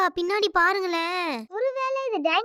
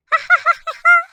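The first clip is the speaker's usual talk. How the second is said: a sudden, loud, high burst of laughter; 10 semitones higher, 9 dB louder than usual. Acted. Announcer bursts into gleeful laughter after the good news, loud high laugh.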